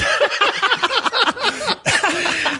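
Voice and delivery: big high laugh at the punchline; laughing hard at own story. Real, not acted. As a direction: let out a high delighted laugh.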